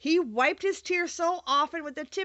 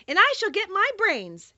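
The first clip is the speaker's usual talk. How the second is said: higher, louder and gleeful; joyful Scarecrow; 9 semitones higher, 4 dB louder than usual. Acted. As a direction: burst out with delight. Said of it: "added the Scarecrow joyfully".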